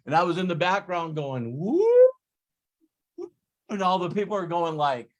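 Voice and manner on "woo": high, loud "woo"; retold cheer, pitched way up; celebratory whoop. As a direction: let out a gleeful winner's whoop. Cheering in the background while winning.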